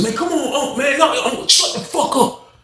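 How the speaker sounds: a cellmate snapping over the noise, yelling; angry yelling. Retold or imitated. Inmate yelling at a noisy cellmate, high angry shout. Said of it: "All you heard was".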